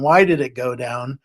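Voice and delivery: ordinary talk, nothing marked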